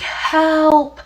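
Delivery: high, drawn-out mock cry for help; imitated screaming. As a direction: mimic a distant scream at half strength. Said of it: "like, repeated screaming".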